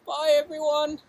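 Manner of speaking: high, shivering, gasping from the cold; in ice cold water. Real, not acted. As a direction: talk while freezing after an ice-water drench.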